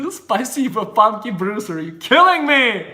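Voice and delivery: high, loud mock-exasperated cry; playful outburst. Real, not acted. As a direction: cry out loudly, about to burst into laughter. Playful exclamation while cracking up.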